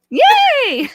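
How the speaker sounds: very high, loud yay; hammed-up yay; mock cheer. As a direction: cheer like a hype host. Celebrating winner.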